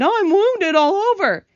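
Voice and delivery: loud, high, drawn-out wail of complaint; hammed-up groan from the hurt centipede. A narrator's reading. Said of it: "groaned the centipede".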